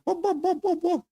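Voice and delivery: high-pitched mock puppy yelps, "whoop" over and over; imitating puppy whoops. Telling the dog about his puppy friends, high yipping whoops. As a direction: yip it like a puppy playing with its friends. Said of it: "running around going".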